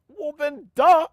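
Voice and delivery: high, loud, exaggerated duh; retold kid's emphatic comeback; mock obviousness. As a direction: blurt it as the most obvious thing. Retells kid's obvious answer.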